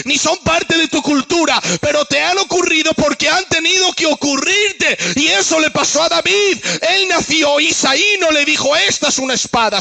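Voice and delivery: rising, chanting sermon cadence; preaching. Acted. Preacher driving the point home to the congregation, building and chanting.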